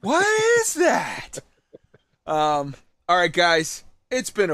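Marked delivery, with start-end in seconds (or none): 0.0-1.4 very high, slow, loud disbelief; baffled dad. Retold or imitated. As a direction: stretch it out high in total confusion. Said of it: "And it's like".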